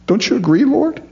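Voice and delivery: high, whining self-pity; false humility. Retold or imitated. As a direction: play the martyr, fishing for sympathy. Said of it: "a false kind of humility".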